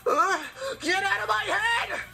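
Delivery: high, pained plea; anguished cry. Acted. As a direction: cry out in torment. Character cries out in torment.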